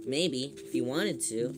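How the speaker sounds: ordinary talk, nothing marked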